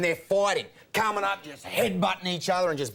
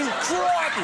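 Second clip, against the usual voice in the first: high, drawn-out crikey; long, high catchphrase call; demonstrated catchphrase; 10 semitones higher than usual. Shown as a demonstration. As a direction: stretch out a high Aussie crikey.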